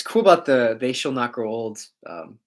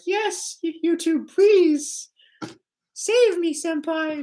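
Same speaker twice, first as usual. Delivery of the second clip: much higher squeaky pleading, mocking begging; pleading fan voice; 5 dB louder, much slower than usual. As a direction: beg YouTube like a starstruck anime fan, way up high. Mock pleading YouTube like a fan.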